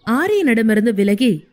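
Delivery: plain reading voice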